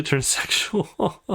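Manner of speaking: amused giggle mid-sentence; laughing. Real, not acted. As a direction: laugh breaking into the words. Player laughs at predictable plot.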